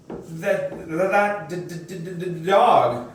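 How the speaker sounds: stuttering on every start, caught by fear; terrified stammer. A narrator's reading. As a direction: force the word dog out through a stammer. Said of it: "I stammered".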